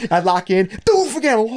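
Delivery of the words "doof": a high, loud mock punch thud; punch sound effect. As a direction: smack out a cartoon punch noise. Punch imitated with noise.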